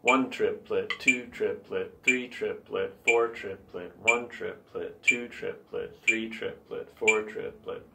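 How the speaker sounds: steady, even syllables, one per triplet note; evenly spaced, metronomic triplet count. Shown as a demonstration. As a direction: count triplets in strict rhythm. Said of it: "I like to count".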